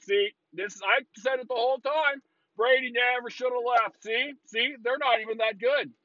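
high, smug I-told-you-so; gloating fan voice. Retold or imitated. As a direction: gloat like a know-it-all fan.

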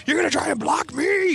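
high, strained imitation of an outraged father; madder dad. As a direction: challenge the kid in mock fury, voice way up. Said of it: "he gets even madder".

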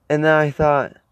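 ordinary talk, nothing marked

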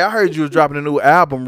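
normal talking voice

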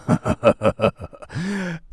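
drawn-out staccato "ha-ha-ha"; mock sinister laugh. Joking that viewers should trust him, mock sinister laugh. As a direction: cap it with a put-on, rising villain laugh.

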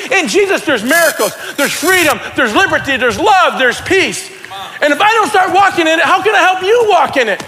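excited preaching yell. Real, not acted. Preacher urging the church to walk in it, excited preaching yell. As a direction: build a rousing list with conviction. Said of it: "I'm just yelling 'cause I'm getting excited".

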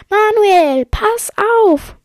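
loud, high-pitched warning; alarmed shout. Acted. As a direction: yell to warn a friend of danger.

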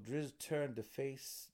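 usual speaking voice

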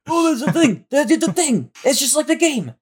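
high, gushing fan voice; excited fan squeal. Retold or imitated. Podcast host imitating an excited fan spotting an Easter egg, high gushing squeal.

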